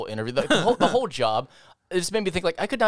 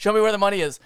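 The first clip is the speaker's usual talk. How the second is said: raised, higher hold-up voice; robber's demand; 8 semitones higher, 3 dB louder, faster than usual. Acted. Robbers to their victims, insistent. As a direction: play the robber pressing harder. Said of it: "they're saying".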